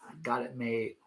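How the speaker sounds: low, soft voice for a self-satisfied cat; contented cat. Pretend. Owner speaking as his cats if they could talk, lightly smug. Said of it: "if my cats could talk, they would say".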